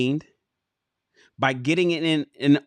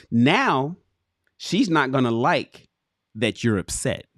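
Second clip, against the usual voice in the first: normal talking voice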